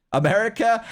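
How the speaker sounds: high, loud "America?" asked as if it's obvious; a knowing, overdone question; mock incredulous America. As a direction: tease the listener with an exaggerated "America?". Mock question about hearing of America.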